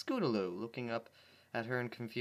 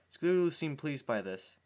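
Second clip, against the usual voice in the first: ordinary delivery, nothing marked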